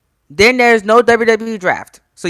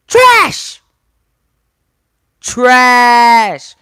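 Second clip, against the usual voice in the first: disgusted shout; 4 dB louder than usual. Real, not acted. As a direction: yell it out in disgust. Fan ranting about ending.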